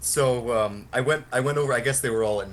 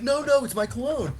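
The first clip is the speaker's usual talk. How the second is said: higher mock of the guy insisting; a hint of a squeaky protest; mock defensive; 14 semitones higher than usual. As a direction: lightly imitate the protesting guy. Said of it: "he's like".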